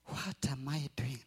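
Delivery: low, soft inner voice; small inner voice. Acted. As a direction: murmur it to yourself. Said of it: "The small voice".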